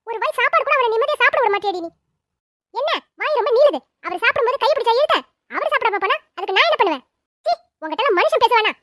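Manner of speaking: loud, heated scolding; angry quarrel. Acted. Quarrel over the son leaving without eating, angry and loud. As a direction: lash out in a household row.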